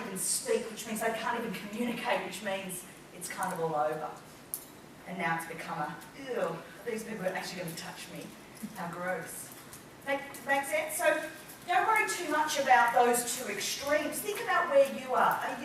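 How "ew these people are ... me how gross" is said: soft, low mock disgust; vol afkeer. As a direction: recoil quietly as if grossed out. Acts out a disgusted speaker.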